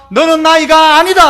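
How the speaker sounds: loud proclamation, rising at the end; loud declaration with 아니다 rising; emphatic. Retold or imitated. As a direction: declare it loudly as God's voice. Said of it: "하나님은요".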